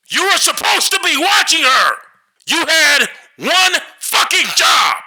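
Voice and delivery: high strained shout, last line slowed; a father yelling blame, out of control; furious father. A narrator's reading. The father screams at the babysitter who lost his daughter. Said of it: "lower and angrier".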